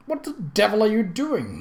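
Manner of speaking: high, sharp demand from the startled surgeon; a touch of indignant alarm. A narrator's reading. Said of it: "Miles demanded".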